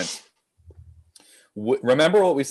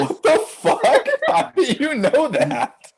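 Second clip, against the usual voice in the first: high, loud, drawn-out astonishment; laughing disbelief; 4 dB louder, slower than usual. Real, not acted. Host amazed a player knew their quote, laughing disbelief. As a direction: exclaim it through laughter, completely floored.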